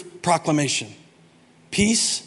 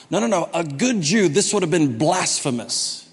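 ordinary talk, nothing marked

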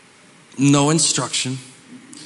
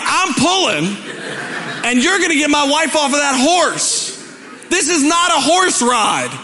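angry shout; 10 semitones higher than usual. Retold or imitated. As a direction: yell at the guide in outrage. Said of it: "I'm like".

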